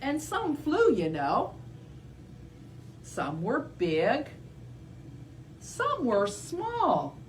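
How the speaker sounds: slow, sing-song read; reading to children. Acted. Teacher reading dinosaur board to kids, slow sing-song. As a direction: spell it out for little ones.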